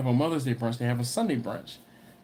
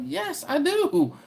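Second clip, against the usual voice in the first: put-on firm kid answer; 12 semitones higher, slower than usual. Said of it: "And I'm like".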